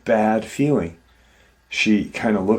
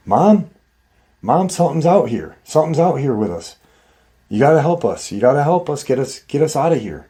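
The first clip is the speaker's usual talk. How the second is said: imitating a boy calling for help, higher and quick; urgent pleading; 4 semitones higher, faster than usual. Youngest son begs mom to get them out, urgent mock pleading. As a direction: rush out a high, insistent plea.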